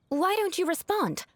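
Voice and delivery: high-pitched indignant question; affronted demand. Acted. Noble questioning an unresponsive commoner, affronted. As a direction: demand an answer, voice lifting high.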